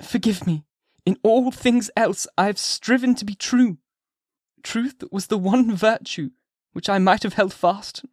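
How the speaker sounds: high, desperate confessing woman; pleading Hester. A narrator's reading. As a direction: beg forgiveness while confessing.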